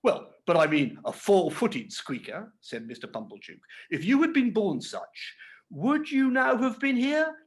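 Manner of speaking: high, self-important uncle, slowing on squeaker; a pompous uncle lecturing the boy; pompous Pumblechook. A narrator's reading. Reader voicing Pumblechook.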